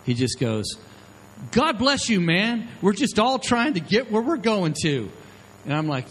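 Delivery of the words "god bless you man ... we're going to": friendly stranger. Retold or imitated. Stranger suddenly turns friendly.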